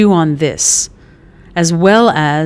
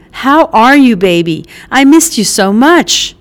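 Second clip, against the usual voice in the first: high, loud delighted mother scolding then hugging; a brash mother jolted awake at her door, then all arms; Mickey, startled mom; 5 semitones higher, 5 dB louder than usual. Acted. Mother surprised by her son's visit, loud and delighted.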